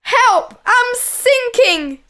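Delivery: high, drawn-out cry for help; loud, desperate storybook shout; panicked yell. A narrator's reading. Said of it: "he yelled".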